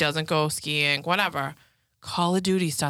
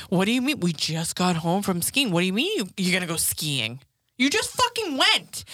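retold angry outburst. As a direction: replay blowing up at a partner. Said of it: "And I get so mad".

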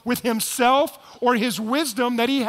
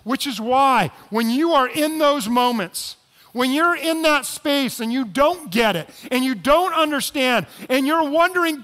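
louder, higher, building sermon push; preaching at full force; 5 semitones higher, 5 dB louder than usual. Acted. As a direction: drive it home to the congregation.

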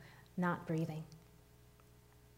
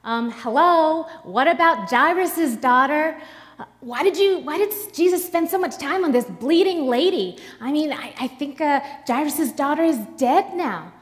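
loud, higher, fast protesting voice; Jairus' friend objects loudly to Jesus, shouting indignantly; 6 semitones higher, 5 dB louder than usual. Acted. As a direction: call out over the crowd in outrage. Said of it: "one of Jairus' friends shouts".

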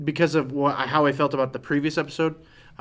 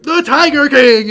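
loud, high excited cry; excited shout; 13 semitones higher, 14 dB louder, slower than usual. Retold or imitated. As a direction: belt it out like a catchphrase. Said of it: "I feel like everyone's joke".

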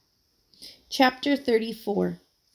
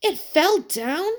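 slow disbelief; 7 semitones higher, 4 dB louder, slower than usual. A narrator's reading. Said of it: "said Buddy in disbelief".

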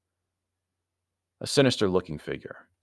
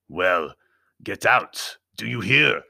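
loud, impatient dismissal; curt order; 8 dB louder, slower than usual. A narrator's reading. Anthony sending the intruder out, loud and curt. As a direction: order him out, loudly.

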